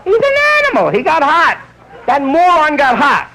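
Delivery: high, scornful nurse voice; disgusted nurse. Retold or imitated. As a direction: sneer at the moron.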